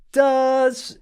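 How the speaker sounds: very high, loud, drawn-out "Does"; imitating the ad voice. Host mimics the ad girl's voice for his co-host, a high drawn-out "Does". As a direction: stretch "Does" way up high like the girl in the ad. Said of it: "her voice is going".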